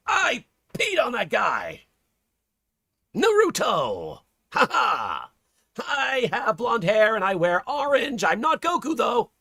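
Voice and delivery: put-on high, slow, shouty ninja boy; brash young ninja yelling; Naruto voice. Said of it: "I shouldn't do Naruto voice".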